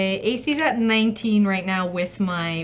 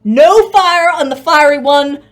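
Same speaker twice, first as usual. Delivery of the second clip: loud called-out instruction, easing off toward the end; raised, emphatic in-character yell; shouting to an ally; 10 dB louder than usual. Acted. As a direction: shout orders across a battlefield. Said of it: "And I shout to Pluto".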